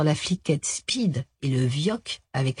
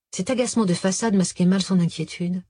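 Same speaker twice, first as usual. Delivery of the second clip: plain reading voice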